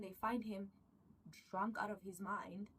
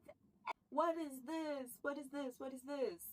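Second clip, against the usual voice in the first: excited binge craving; 7 semitones higher, 5 dB louder than usual. Retold or imitated. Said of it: "and I'm like".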